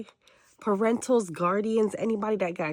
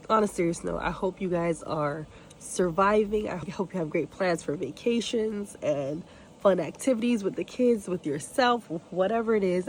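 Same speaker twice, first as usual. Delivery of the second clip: ordinary talk, nothing marked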